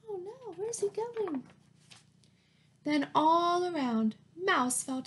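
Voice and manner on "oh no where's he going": higher, softer alarm; mild worry. A narrator's reading. As Mouse tumbles away, mild mock alarm. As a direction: sound a little alarmed.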